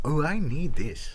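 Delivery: slow, put-on voice of Ricky's wife; imitating Ricky's wife trying the gumbo, slow and put-on; wife's accent. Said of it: "taken a hit of that and been like".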